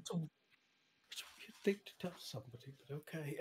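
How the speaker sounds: whispered under the breath. Acted. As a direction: barely breathe it, unvoiced. NPC mutters reply.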